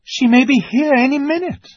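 high, loud, urgent warning; alarmed. A narrator's reading. Susan warns that the witch is coming, alarmed. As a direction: cry the warning out.

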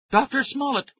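high, loud call of a name; shouting. Acted. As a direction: shout into the empty room. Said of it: "Nicky and Tara both shouted".

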